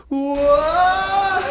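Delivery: high, surprised whoa; exaggerated movie gasp of surprise; mock movie whoa. Parodying a movie water-break moment, high mock whoa. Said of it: "in every movie, it's literally like".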